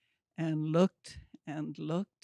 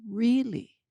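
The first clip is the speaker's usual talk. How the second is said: mild disbelief; 6 semitones higher than usual. Retold or imitated. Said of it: "every time I said it, it was more ridiculous".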